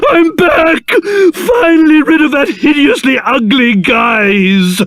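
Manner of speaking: high, drawn-out cry of jubilation; overjoyed. Acted. King finds himself back to normal, overjoyed. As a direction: burst out in hammed-up delight, stretching the words. Curse lifted, character rejoices.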